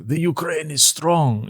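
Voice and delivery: slow, high, proud declaration; Seinfeld subway guy. Retold or imitated. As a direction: boast it slowly like a proud stranger. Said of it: "the guy on the subway who's like".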